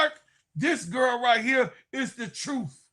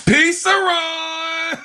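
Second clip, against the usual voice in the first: drawn-out shout; 4 semitones higher, 8 dB louder, much slower than usual. Retold or imitated. Repeating the hyped call.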